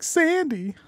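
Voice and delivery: high, stretched-out Travolta call; Travolta impression. Retelling the Punisher door scene, as hammy Travolta. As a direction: lean into a hammy John Travolta. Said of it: "John Travolta's like".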